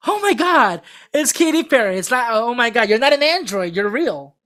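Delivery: high, loud forced fan gushing; fake excitement. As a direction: ham up being star-struck on command. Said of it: "'cause it looked so fake".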